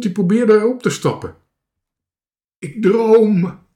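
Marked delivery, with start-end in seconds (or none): 2.6-3.7 high, loud drawn-out exclamation; amazed cry. A narrator's reading. Marinka sees animals on the boat, amazed cry. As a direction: cry out in disbelief. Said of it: "riep Marinka".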